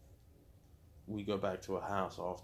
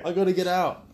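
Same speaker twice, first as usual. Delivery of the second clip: high, loud frantic cry; mock panicked shout; 11 semitones higher, 14 dB louder than usual. Telling the host he had to escape, mock panicked. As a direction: sell the urge to bolt.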